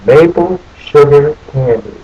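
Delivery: plain reading voice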